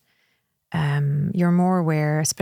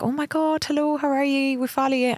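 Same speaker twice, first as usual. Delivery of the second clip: high gushing fan voice, pitched way up; 8 semitones higher than usual. Retold or imitated. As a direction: gush like an excited fan meeting a celebrity. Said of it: "they'll be like".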